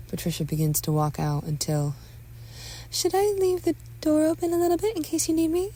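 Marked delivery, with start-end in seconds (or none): 2.9-5.7 very high-pitched motherly offer; hovering mother. Acted. Mother lingers at son's door, high solicitous voice. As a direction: fuss gently, pitched way up.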